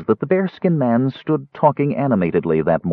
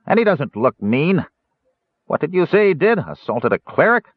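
dwarf slave buyer, higher and scornful; gruff dwarf; 7 semitones higher than usual. Acted. Dwarf dismissing Caramon to the dealer, scornful. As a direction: sneer at the prisoner's flaws.

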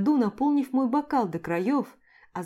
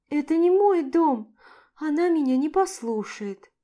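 sad; 6 semitones higher than usual. A narrator's reading. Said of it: "грустно заключила я".

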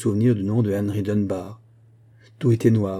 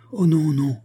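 higher-pitched anguished refusal, narrated; crying out; 6 semitones higher, 3 dB louder than usual. A narrator's reading. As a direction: cry out to stop him, without shouting. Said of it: "s'écria-t-elle". (s'écrier d'une voix plus aiguë)

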